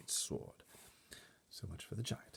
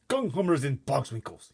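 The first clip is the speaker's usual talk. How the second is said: loud, higher giant's yelp; cry out; 7 semitones higher, 14 dB louder than usual. A narrator's reading. Giant hitting his head on chandelier, crying out. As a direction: bellow in surprised pain. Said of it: "he cried".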